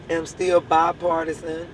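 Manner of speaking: ordinary talk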